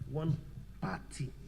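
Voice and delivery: "party" set apart after a pause, falling; stress on party. Shown as a demonstration. Teacher correcting person to party, stressed "party". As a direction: pause after One, then land on "party". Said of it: "I emphasize on the word party".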